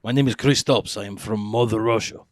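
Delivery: loud, heavy mock Russian accent. Joking with friends about scary accents, loud mock Russian accent. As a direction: play a tough Russian guy, Russian accent throughout. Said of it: "This is ugly accent".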